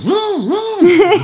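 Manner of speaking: high-pitched buzzing drill noise; mimicked DeWalt drill whir; imitated power drill. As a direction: buzz like a drill in long, high bursts. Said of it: "picks up the DeWalt drill, goes".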